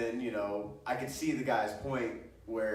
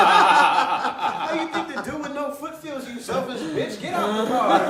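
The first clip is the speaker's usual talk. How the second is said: mock outrage; 12 semitones higher, 12 dB louder, slower than usual. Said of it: "I was like".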